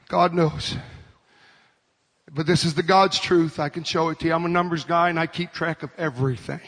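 low, soft confiding aside; preach. Real, not acted. Preacher confiding to the congregation, low and soft. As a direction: drop low and quiet to confide.

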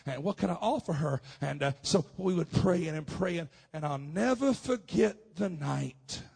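ordinary talk, nothing marked

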